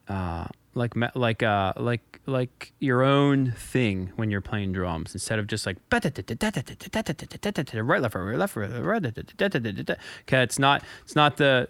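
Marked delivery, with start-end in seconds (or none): 5.9-9.9 stiff marching count, each word clipped; military march. Shown as a demonstration. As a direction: chant the sticking like a drill march. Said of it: "not some military march".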